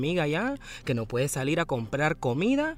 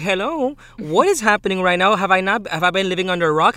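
imitating disbelief; 4 semitones higher, 7 dB louder than usual. Retold panicked call to friend.

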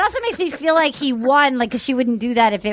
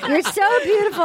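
higher, laughing through a dreamy line; laughing mock swoon; 7 semitones higher than usual. Laughing while imitating Jed swooning at her. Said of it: "He's like".

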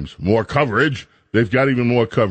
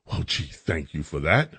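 sarcastic aside; 9 semitones lower, 8 dB quieter than usual. Real, not acted.

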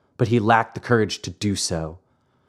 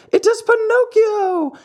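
even higher, louder, climbing cry; rising excited yell; 5 dB louder than usual. A narrator's reading. The marionettes yell for their brother, climbing higher and louder. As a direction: push the repeat higher than the first call. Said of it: "yelled all the marionettes".

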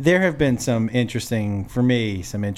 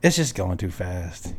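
low, soft, slow let-down; soft lame excuse; 9 semitones lower, 6 dB quieter, slower than usual. Retold or imitated. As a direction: say it gently and slowly. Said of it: "worst line of all time. Ready?".

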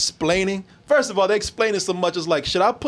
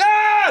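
short high yelp, imitating a guy yelling; a sudden hammed-up "Ah!"; mock yell; 13 semitones higher, 5 dB louder than usual. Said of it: "There's a dude just yelling".

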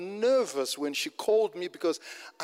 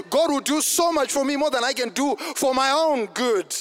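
higher, driving sermon delivery; fervent preaching; 6 semitones higher, 5 dB louder than usual. Real, not acted. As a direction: preach it hard, phrase after phrase. Preaches at full force.

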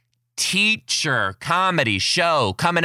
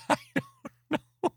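laughing squeak; 6 dB quieter than usual. Real, not acted. Laughing at kid's question.

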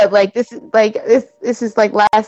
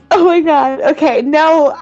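laughing; 7 semitones higher than usual. Real, not acted. Laughing after joke.

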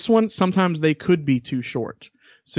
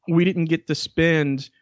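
ordinary delivery

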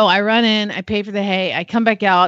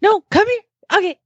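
higher, sing-song coaxing; coaxing the dog; 10 semitones higher, faster than usual. Retold or imitated. Owner coaxes her dog to the door, higher coaxing voice. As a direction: sweet-talk a dog toward you. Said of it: "so then I'm trying, like".

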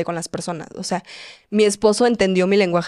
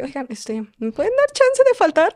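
hesitant start, then very high little voice; mock timid request; 9 semitones higher than usual. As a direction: exaggerate being scared to ask a favour. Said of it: "pero tengo miedo". (petición tímida fingida, con vocecita aguda)

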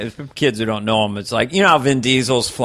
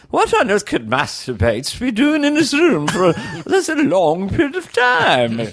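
higher, slow mock-posh lady; 9 semitones higher, slower than usual. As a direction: play the aristocrat, slowly and laughing. Lady voiced again.

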